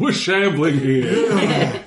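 high, very slow zombie voice, laughing; indignant zombie. Acted. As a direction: shamble the words out, high and slow. Voicing the dead.

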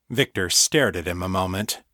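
ordinary delivery, nothing marked

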